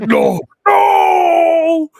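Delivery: loud, high, drawn-out wail of no; long bellowed lament; anguished Gimli. Acted. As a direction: cry out in grief at a tomb. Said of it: "like Gimli when he goes to Balin's tomb".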